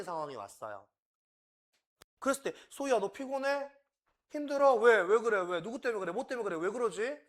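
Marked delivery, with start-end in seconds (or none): 2.7-7.2 much higher, louder string of pestering questions; pushy questioning. Shown as a demonstration. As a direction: nag her with question after question. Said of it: "이렇게 질문하면 안 돼요". (소희에게 캐묻는 부모처럼 높고 다급하게)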